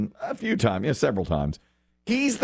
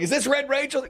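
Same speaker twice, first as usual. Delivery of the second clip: high excited question. Retold or imitated. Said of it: "Then he's like".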